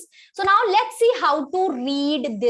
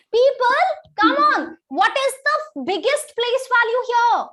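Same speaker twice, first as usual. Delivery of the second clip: urging; 7 semitones higher, 5 dB louder than usual. Real, not acted. Teacher urging class to answer.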